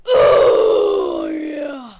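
loud drawn-out cheer; real shout of triumph; victory shout. Real, not acted. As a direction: belt it out. Celebratory yell.

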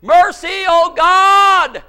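loud, high pleading shout; crying out for mercy. Acted. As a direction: cry out to God at full voice. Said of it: "if you'll cry out".

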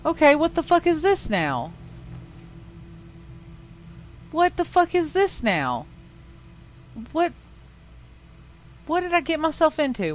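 high, shocked and swearing; stunned by what you just found; shocked disbelief. Real, not acted. Finds something shocking in the game.

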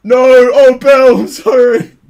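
high, loud laughing apology. Real, not acted. Prankster cries out sorry to her friend Bill.

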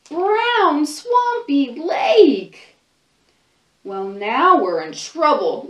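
put-on lively read-aloud for little kids, voices and all; storybook reading. Librarian reading a picture book to children, animated storytelling.

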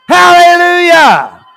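high, drawn-out shouted hallelujah; exultant shout. Real, not acted. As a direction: let it ring out in triumph. Demonstrates exultant praise to congregation.

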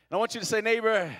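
loud call of neighbor; preacher voice. Shown as a demonstration. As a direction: rouse them to answer back. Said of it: "We gotta get our preacher voice on".

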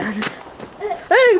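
usual speaking voice

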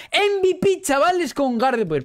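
high, proud announcement; triumphant. Real, not acted. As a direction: boast the MVP to the crowd. Celebrating MVP win. (anuncio triunfal y agudo)

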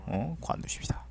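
unvoiced muttered aside; whisper. Real, not acted. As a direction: drop to a whisper and give up. Gives up mid-rant, aside.

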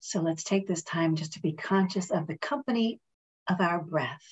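calm, measured meditation voice with long pauses; guided meditation. Real, not acted. Reverend leading friends in a breathing meditation, calm and unhurried.

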